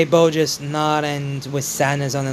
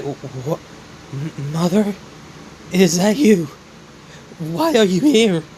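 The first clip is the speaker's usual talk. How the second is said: stunned disbelief; slower than usual. Acted. Son sees dead mother in dream.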